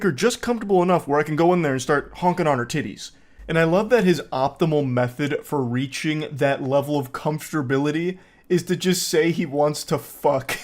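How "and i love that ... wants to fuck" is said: ordinary talk, nothing marked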